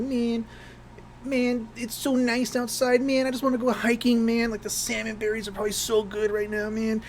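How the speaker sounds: complaining hippie coworker begging to go hiking; imitating a hippie. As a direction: whine it like a lazy hippie. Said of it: "that's exactly, like, how he talked".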